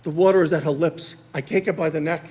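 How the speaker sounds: normal talking voice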